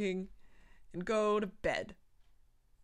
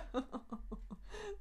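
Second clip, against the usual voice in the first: high laugh; 12 semitones higher, 12 dB quieter than usual. Real, not acted. Laughing at own old writing.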